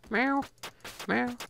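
high squeaky meows, rising; cat meows. Acted. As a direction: meow like a little cat. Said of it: "a lot of cat noises will be made today".

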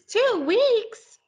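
high, loud shocked "Two weeks?"; shocked disbelief. Acted. Caterpillar hearing the wait, stunned and loud. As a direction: blurt it out, stunned. Shocked at how long it takes.

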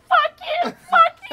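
high furious yelling, fuck you twice; the crying drunk woman screams at the comedian; screaming at me. Retold or imitated. Shrill furious yell. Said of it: "And she's like".

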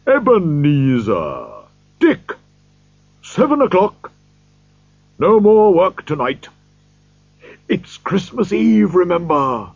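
put-on a hearty old master calling his clerks; happy calling out. A narrator's reading. Fezziwig to his clerks, calling out happily. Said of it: "called out in a rich, deep, happy voice".

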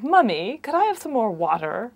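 imitated English-accented toddler whine; English kid whining. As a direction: whine politely like a little English child. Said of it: "you have the English kid who's saying".

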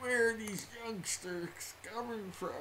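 put-on slow, high cranky old man voice. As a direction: grumble like a grumpy grandpa. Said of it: "It's my old man voice".

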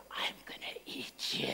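slow, breathy playful threat; soft, slow child's monster whisper; whispered menace. Retold or imitated. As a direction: whisper it like a kid threatening his bacon. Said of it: "he goes".

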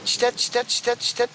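evenly repeated imitated bird call; goldfinch search call. Shown as a demonstration. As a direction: chant it lightly like a searching finch. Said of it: "dediğimiz aramalarıdır". (sakanın arama ötüşü taklidi)

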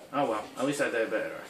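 ordinary talk, nothing marked

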